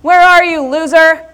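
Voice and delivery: loud, high teasing call across the room; teasing shout. Retold or imitated. Friend shouting from the stage, teasing loud call. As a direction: yell it out to tease her. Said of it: "Andre's going".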